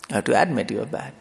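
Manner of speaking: ordinary talk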